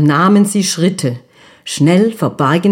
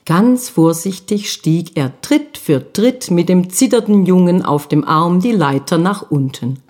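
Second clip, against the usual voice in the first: plain reading voice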